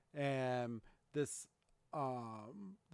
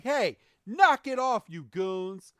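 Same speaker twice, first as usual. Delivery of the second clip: loud, high shout of outrage; shouting at goons; 6 semitones higher, 9 dB louder than usual. Acted. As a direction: yell it higher and louder at the bullies. Ash confronts the hunters.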